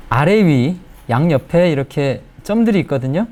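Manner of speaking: ordinary talk, nothing marked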